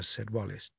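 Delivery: usual speaking voice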